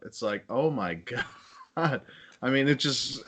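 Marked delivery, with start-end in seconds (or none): 0.5-2.0 quiet laugh breaking into the words. Real, not acted. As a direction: say it through a soft, incredulous laugh. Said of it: "I'm laughing".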